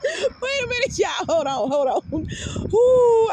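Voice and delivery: high squeaky words through real laughter; barely getting words out for laughing; laughing hard. Real, not acted. Retelling the friend's piano story, cracking up with real laughter. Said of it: "I gotta breathe".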